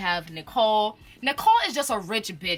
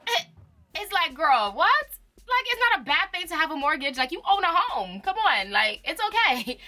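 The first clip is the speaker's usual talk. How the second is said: much higher, fast disbelieving rant; incredulous high rant; 9 semitones higher, 3 dB louder than usual. Real, not acted. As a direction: pitch it way up in disbelief. Incredulous rant at rich arguing.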